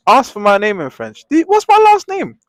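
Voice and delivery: high, loud mocking girl voice. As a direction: squeal it like the girl begging for a drawing.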